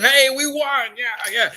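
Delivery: high, loud smug cheer; a demon's high, loud cheer; gloating demon. Retold or imitated. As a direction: crow it like a winning minion. Demons gloating over the cross.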